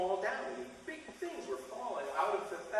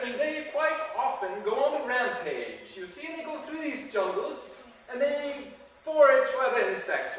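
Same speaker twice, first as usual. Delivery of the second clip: a hint of a lecturing Englishman, higher; mock English accent; 6 semitones higher, 7 dB louder than usual. Englishman lecturing the tourists on army ants, light English accent. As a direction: explain it like a know-all Englishman. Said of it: "an Englishman".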